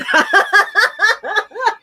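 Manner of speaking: helpless high laugh over the music; high burst of laughter. Real, not acted. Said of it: "driving me fucking crazy".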